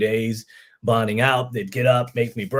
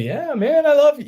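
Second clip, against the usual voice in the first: retold gushing yes, high and louder; eager reply; 7 dB louder than usual. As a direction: play an over-keen young recruit, pitched way up. Acts out keen reply to recruiter.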